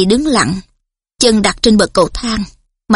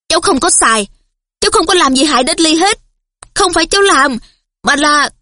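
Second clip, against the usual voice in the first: cold reply; 6 semitones higher than usual. A narrator's reading. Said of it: "Harry lạnh lùng nói ngay".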